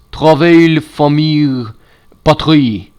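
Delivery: Travail, famille, patrie with a French accent. Shown as a demonstration. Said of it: "in a more French voice".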